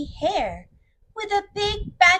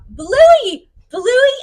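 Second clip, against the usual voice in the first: excited; 11 semitones higher, 11 dB louder than usual. Acted. Excited mention of Bluey.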